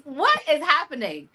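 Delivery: normal talking voice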